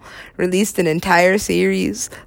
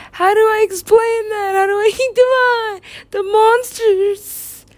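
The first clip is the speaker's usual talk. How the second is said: high, drawn-out mock lament; hammed-up wail; 12 semitones higher, slower than usual. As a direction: stretch it out in a much higher wail. Struggling to explain the monsters.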